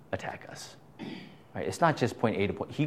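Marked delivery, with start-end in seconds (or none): 0.1-0.8 hushed unvoiced whisper. Real, not acted. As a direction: drop to a whisper. Voice sinks while warning of devil.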